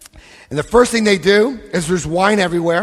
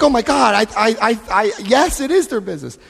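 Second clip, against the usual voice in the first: panicked; 6 semitones higher than usual. Retold or imitated. Panics at the dinner table.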